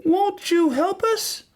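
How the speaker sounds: very high, slow plea; little girl crying out. A narrator's reading. Little girl begging God, high pleading cry. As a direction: beg for help as a little girl. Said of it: "she cried".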